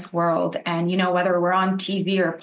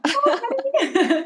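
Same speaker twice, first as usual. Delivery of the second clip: much higher, slow, drawn-out laughing honey; mock sweet endearment; slower than usual. Guest savours the host's phrase again, laughing and drawn out. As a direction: savour it like a delighted little tease. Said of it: "I love how you said".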